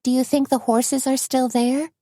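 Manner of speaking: Tony's voice. A narrator's reading. Narrator voices Tony.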